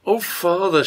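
put-on lightly lifted, happy little-girl greeting; cheerful. A narrator's reading. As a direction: greet father with glad relief. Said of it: "with such a cheerfulness coming over".

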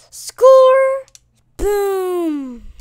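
loud, high victory cry; gleeful yelling celebration; triumphant shout. Real, not acted. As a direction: belt it out like you just scored. Celebrating a score in game.

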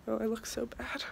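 soft whisper. Real, not acted. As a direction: whisper it to yourself. Quiet aside on camera.

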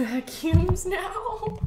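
high, slow, loud disbelief on vacuums; incredulous squeal. Real, not acted. Finding out [name] sells vacuums, squealing in disbelief.

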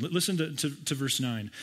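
ordinary talk, nothing marked

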